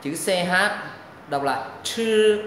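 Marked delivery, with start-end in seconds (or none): 1.8-2.4 "chư" with a puff, drawn out, tongue curled. Shown as a demonstration. Teacher reads the letters C H, tongue-curled "chư". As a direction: curl the tongue back and stretch "chư". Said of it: "âm uốn lưỡi".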